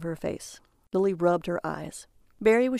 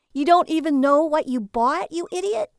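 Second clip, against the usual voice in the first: louder, high, exasperated insult; angry; 8 semitones higher, 6 dB louder than usual. A narrator's reading. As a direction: snap at him in anger. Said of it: "Lily angrily sashed".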